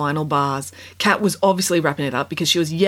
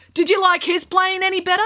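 put-on challenging girl voice; 14 semitones higher than usual. A narrator's reading. Kat challenging judge.

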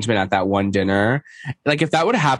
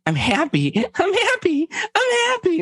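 mock-cheerful, climbing very high; Shannon impression; slower than usual. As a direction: imitate someone insisting, higher with each repeat. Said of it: "Shannon just keeps being like".

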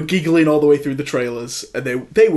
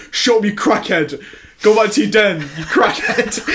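a touch of an angry heckler, slightly raised; mild mock shout; 6 semitones higher than usual. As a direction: snap at the drunk guy, half-laughing by the end. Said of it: "kept calling him a crackhead".

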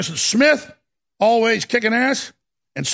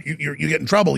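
ordinary talk, nothing marked

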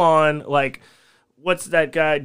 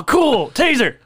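higher, louder faux-delighted reply; mock cheerful; 10 semitones higher, 6 dB louder than usual. Driver jokes to the cops, faking delight. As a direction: act thrilled about the taser. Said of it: "I'm like".

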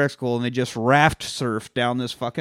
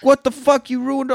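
angry vineyard owner; 10 semitones higher than usual. Retold or imitated. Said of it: "except in Italian".

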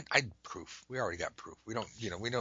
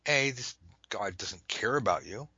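ordinary delivery

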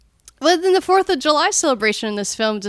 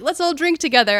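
cheery invitation; 7 semitones higher, 4 dB louder than usual. Retold or imitated. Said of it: "And they're like".

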